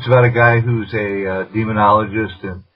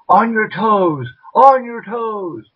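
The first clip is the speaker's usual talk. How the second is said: high, drawn-out command; imagined gunman's order; 11 semitones higher, much slower than usual. Acted. Gunman ordering a victim onto tiptoe, high mock command. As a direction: order them up in a high, stretched voice. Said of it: "putting a gun to your head and saying".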